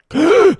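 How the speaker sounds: shocked gasp. Pretend. Imagined shocked reaction.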